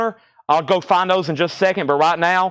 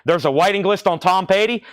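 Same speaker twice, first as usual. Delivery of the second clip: ordinary talk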